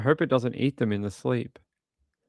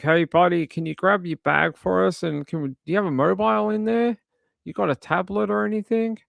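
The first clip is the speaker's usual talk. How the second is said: mock officer; 8 semitones higher than usual. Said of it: "When they're like".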